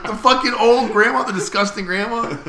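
high, loud appalled question; asking if it was the old woman, high and loud; horrified disbelief. Retold or imitated. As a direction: recoil in disgust.

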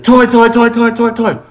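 very high imitated kid chant; nagging child. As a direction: mimic a child's relentless, high-pitched demand. Retold child chanting during tantrum.

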